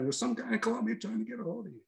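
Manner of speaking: high-pitched, quick mock messenger voice; security chief impression. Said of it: "He had the head of our security track me down".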